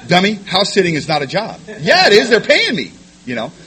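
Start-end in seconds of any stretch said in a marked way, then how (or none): 0.1-1.6 loud, higher-pitched telling-off; exasperated scolding. Retold or imitated. Sponsor correcting his sponsee about house sitting, exasperated scolding. 1.8-2.9 very high, loud squawk of protest; indignant protest. Retold or imitated. Sponsee arguing that house sitting pays, indignant protest.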